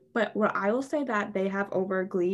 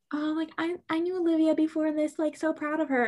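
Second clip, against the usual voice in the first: higher-pitched imitation of a name-dropper; mock social-media bragging; 8 semitones higher than usual. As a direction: mimic someone claiming an old friendship, pitched up. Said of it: "trying to be like".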